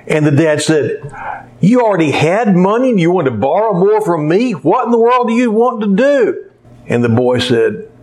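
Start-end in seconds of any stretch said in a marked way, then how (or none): 1.6-6.5 much higher, exasperated; a father scolding the boy; outraged dad. Retold or imitated. Dad outraged at boy's money.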